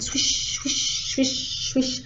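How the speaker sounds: unvoiced airy swishes; hushed imitation of gusting wind; wind swishing. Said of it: "the wind in the trees outside went".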